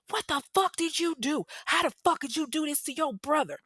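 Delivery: high-pitched furious mom, not full volume; imitated angry screaming. Mother bursting in on her son, mock screaming in outrage. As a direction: act out an outraged scream without real loudness. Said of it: "she's screaming at him".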